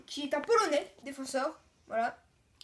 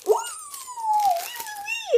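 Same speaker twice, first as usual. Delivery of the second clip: excited cheer; 5 dB louder than usual. Real, not acted. Finally pulls a French player.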